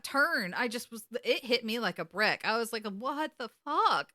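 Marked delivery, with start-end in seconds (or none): 2.9-4.1 high, slow, drawn-out astonishment; stunned disbelief. Retold or imitated. Fan recalls a turn hitting like a brick, stunned. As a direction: stretch each word in retold shock.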